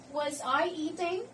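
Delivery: "eating" climbing high at the end; rising question intonation. Shown as a demonstration. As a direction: let the pitch rise right through "eating". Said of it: "intonation is rising at the end".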